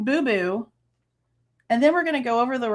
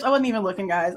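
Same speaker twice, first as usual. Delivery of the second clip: ordinary talk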